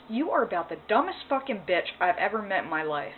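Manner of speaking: ordinary delivery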